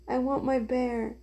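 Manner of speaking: put-on soft, drowsy child fading away; sleepy little voice. A narrator's reading. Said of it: "a little sleepy voice saying over and over".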